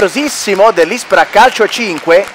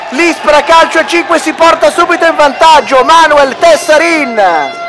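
breathless italian goal commentary; 6 semitones higher than usual. Real, not acted. After goal, still elated.

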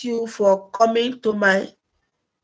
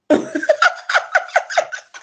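high burst of laughter; real high giggle. Real, not acted. Laughing on live stream.

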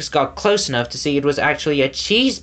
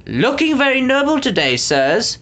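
enthusiastic exclaim; 10 semitones higher than usual. A narrator's reading. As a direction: gush over the nobles. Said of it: "another enthusiastically exclaimed".

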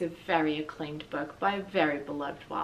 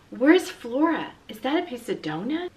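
searching around, bewildered; Ulysses dazed; 5 semitones higher, 6 dB louder than usual. Acted. Dazed Ulysses after the crash.

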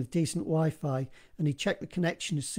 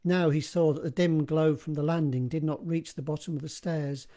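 ordinary delivery, nothing marked

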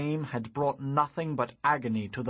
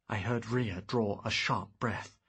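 usual speaking voice

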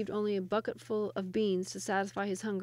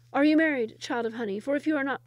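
eager questioning; 5 semitones higher, 7 dB louder than usual. Acted. Sultan questions first woman.

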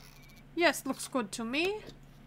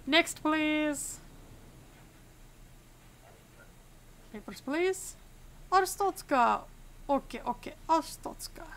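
hammed-up mock Arstotzkan accent; deliberately bad Arstotzkan accent; awful Arstotzkan accent. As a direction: play a border inspector with a put-on Arstotzkan accent. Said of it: "I cannot stop doing this awful Arstotzkan accent".

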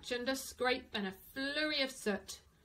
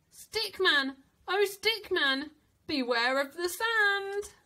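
higher, louder chanted warning; warning refrain; 6 semitones higher, 5 dB louder than usual. A narrator's reading. Narrator warning the floating Stickman, calling.

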